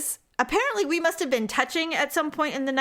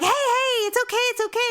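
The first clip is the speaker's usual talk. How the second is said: high, insistent re-enacted reassurance; urgent reassurance; 12 semitones higher than usual. Wife bear-hugging her dreaming husband, urgent reassurance. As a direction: talk someone out of a nightmare, pitched high. Retelling rousing husband from nightmare.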